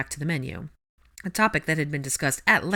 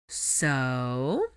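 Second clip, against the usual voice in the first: lazy drawl. Acted. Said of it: "in a lazy sort of drawl".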